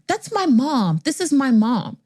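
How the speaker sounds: mock-indignant young woman correcting someone; indignant correction. Daughter corrects doctor's mistake.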